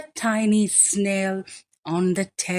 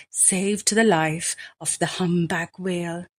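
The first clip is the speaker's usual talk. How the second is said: ordinary delivery, nothing marked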